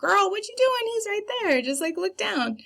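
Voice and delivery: very high-pitched heckle from the audience; high, sing-out "girl, what are you doing"; exasperated audience member. Acted. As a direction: call out in a squeaky, mock-exasperated voice. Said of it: "the audience would be like".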